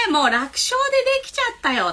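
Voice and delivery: put-on high-pitched child finding it easy; confident child. Child telling teacher the phonics was easy, high and confident. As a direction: voice a child breezily announcing success. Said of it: "というお子さんには".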